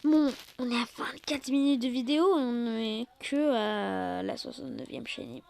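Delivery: soft whispered aside. Real, not acted. Telling viewers the video length, whispered. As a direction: whisper the update.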